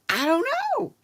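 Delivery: high-pitched mock helplessness; imitating someone totally at a loss; helpless shrug. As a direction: throw up your hands in a high, clueless voice. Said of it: "throws up her hands like".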